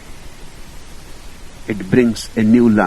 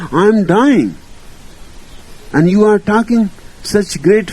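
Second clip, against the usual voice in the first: angry protest; 8 semitones higher than usual. Retold or imitated. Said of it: "He was angry, so angry".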